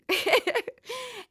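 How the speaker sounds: short amused laugh, high; a quick laugh at the memory. Real, not acted. Laughs after the retold quote.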